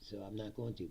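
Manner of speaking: ordinary talk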